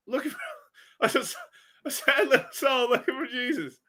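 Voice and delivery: high voice breaking into laughter; cracking up. Real, not acted. Streamer joking about being a lost soul, cracking up. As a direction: try to finish the line while laughing. Cracks up at own mock confession.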